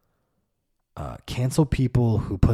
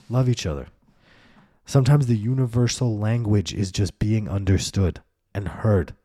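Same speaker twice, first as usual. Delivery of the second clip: ordinary talk, nothing marked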